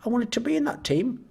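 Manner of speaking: high, whiny mock sulk over music; wailing, hammed-up sulk; whiny kicked player. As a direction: whine like a spoilt kid who got dropped.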